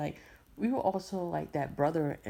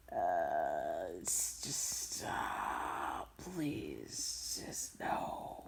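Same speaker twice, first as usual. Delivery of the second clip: weak, whispered begging off; very soft, low, reluctant refusal; uneasy whispered plea; 12 semitones lower, 7 dB quieter, much slower than usual. Retold or imitated. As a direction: whisper it haltingly, pleading to be left alone. Retold uneasy reply to flirting.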